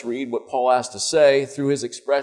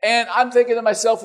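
ordinary delivery

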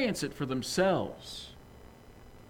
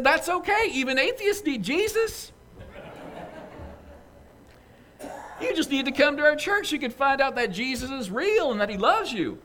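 much higher, bright pushy church lady voice; eager church lady; 10 semitones higher, 3 dB louder than usual. Acted. Church lady urging her boss to come, high and eager. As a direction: press the invitation on him, chirpy. Lady without missing a beat.

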